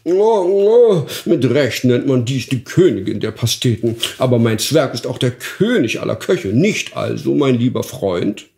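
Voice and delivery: the duke swooning over the pie; delighted duke. Acted. Duke praises the pie to his guest, delighted. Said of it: "schlug die Augen auf zur Decke und sprach".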